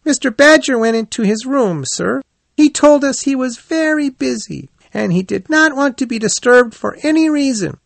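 very high, earnest little voice; young hedgehog. Acted. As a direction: tell it like a small polite child creature. Young hedgehog explains Badger's absence.

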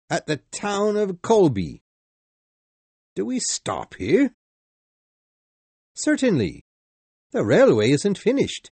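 high, loud official answering; brisk railway conductor. Acted.

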